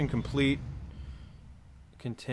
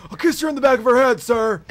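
shouting recruit; 10 semitones higher, 8 dB louder, faster than usual. Pretend. Recruit answering drill sergeant.

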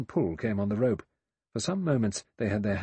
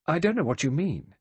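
higher, louder, sharp retort; 7 semitones higher, 5 dB louder than usual. A narrator's reading. Vera answering the old general, sharply. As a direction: cut him off sharply. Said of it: "She said sharply".

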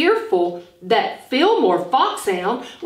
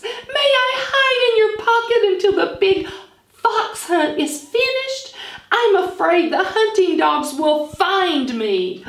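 much higher, pleading little fox; 10 semitones higher than usual. Acted. Fanny Fox begging Hi-Hat to hide her, high and pleading. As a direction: beg like a frightened small fox. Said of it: "said Fanny Fox".

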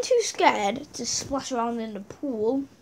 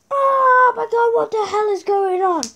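imitated screaming; 9 semitones higher, 7 dB louder than usual. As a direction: wail it like the screaming girl. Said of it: "starts screaming".

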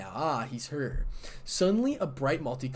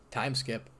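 ordinary delivery

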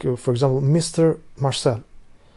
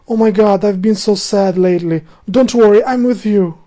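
higher and louder, a hammed-up girly gush; mock girly voice; 6 semitones higher, 6 dB louder than usual. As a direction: play a gushing girlfriend, overdone.

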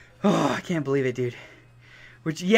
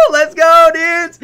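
high, loud laugh bursting into a cheer; triumphant laughing shout; 11 dB louder, slower than usual. Real, not acted. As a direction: roar with joy to chat. Confirmed shiny, celebrating.

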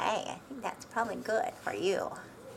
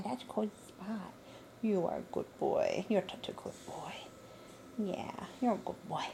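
lower, softer, half-whispered praise; soft soothing dog talk; 3 semitones lower, 3 dB quieter than usual. Real, not acted. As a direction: hush and soothe the pup. Soothing the puppy softly.

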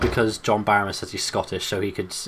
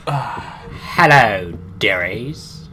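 mock old lady greeting; 8 dB louder, much slower than usual. Greeting viewers in put-on voice.

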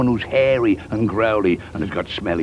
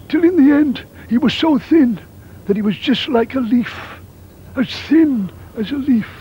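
higher, louder squeaky telling; thin high voice; 11 semitones higher, 5 dB louder than usual. A narrator's reading. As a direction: make the voice thin as a leaf. Wolf thinning to a leaf.

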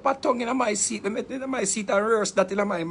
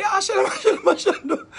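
high-pitched ecstatic gibberish; showy mock tongues, way up high; outburst of fake tongues; 11 semitones higher, 5 dB louder than usual. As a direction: leap up and cry out the rehearsed tongues for the church. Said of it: "people, me daddy".

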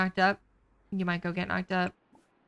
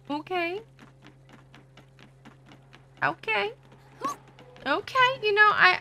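each okay higher and louder; rising, louder okays building up; climbing okays; 12 semitones higher, 4 dB louder than usual. Real, not acted. Rising okay repeated, climbing pitch.

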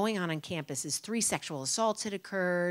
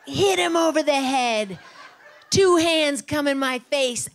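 usual speaking voice

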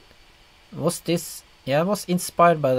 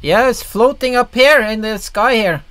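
excited; 8 semitones higher, 7 dB louder than usual. Real, not acted. Excited pointing at floating figure.

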